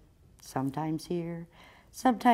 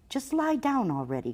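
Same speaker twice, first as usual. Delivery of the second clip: higher-pitched complaining mouse; mildly whiny little mouse; 6 semitones higher than usual. A narrator's reading. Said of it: "complains the mouse".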